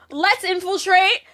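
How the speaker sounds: the editor pitching a wild plan; lightly voiced eager editor; excited boss. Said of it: "he's like".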